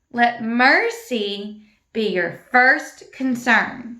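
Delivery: slow, drawn-out verse recitation. Shown as a demonstration. Teacher modelling the verse slowly with motions. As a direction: say it slowly so the class can follow. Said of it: "Do like that for first concern".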